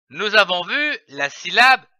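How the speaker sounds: ordinary delivery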